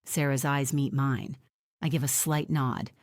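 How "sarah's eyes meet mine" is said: ordinary delivery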